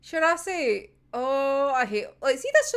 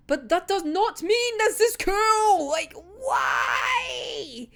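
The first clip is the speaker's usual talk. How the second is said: frustrated cry; 6 semitones higher, slower than usual. Real, not acted. Fan protesting a plot turn, frustrated wail. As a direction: cry out at the unfairness. Ranting about unfair plot.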